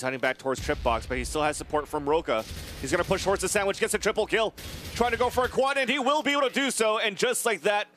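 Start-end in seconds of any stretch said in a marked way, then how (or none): 2.8-7.9 rising, louder excited call; hyped play-by-play. Real, not acted. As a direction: build the hype as the kills come. Play-by-play of a quad kill.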